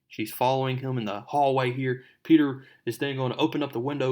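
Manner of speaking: ordinary talk, nothing marked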